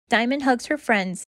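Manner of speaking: plain reading voice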